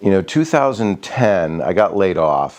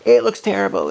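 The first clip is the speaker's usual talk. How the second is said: higher, a hammed-up whine imitating his wife; mock whiny wife. Husband imitating his wife's complaint about the yard, mock whiny and higher. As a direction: play the wife complaining, pitched up, half mocking. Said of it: "She's like".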